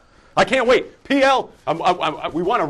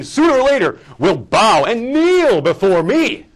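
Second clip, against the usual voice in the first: slow, loud tyrant's decree; dark lord; 5 semitones higher, 5 dB louder, slower than usual. Acted. I don't believe you're even talking like this.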